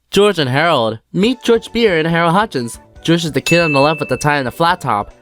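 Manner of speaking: ordinary delivery, nothing marked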